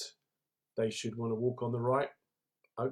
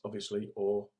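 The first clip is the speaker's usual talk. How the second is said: ordinary delivery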